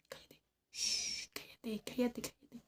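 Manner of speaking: urgent repeated whispered hush; shushing whisper. Retold or imitated. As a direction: keep shushing her, barely voiced.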